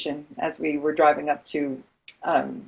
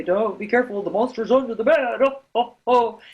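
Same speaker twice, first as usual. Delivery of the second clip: jocular spooky warning; 3 semitones higher than usual. Retold or imitated. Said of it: "there's some jocular, silly people who say".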